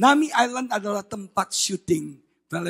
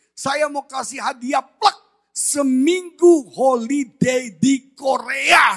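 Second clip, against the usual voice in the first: excited announcement; 3 semitones higher, 4 dB louder, slower than usual. Retold or imitated. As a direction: build to a slow, drawn-out big reveal. Caller announces the gift. (pengumuman hadiah heboh, diulur panjang)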